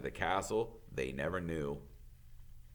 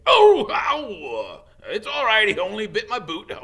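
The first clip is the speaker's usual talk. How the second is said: high loud yelp, then brave; ow, shrug off the bite; yelp of pain; 12 semitones higher, 13 dB louder than usual. Acted. Hagrid bitten by Norbert, yelps much higher and louder. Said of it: "bit him in the leg".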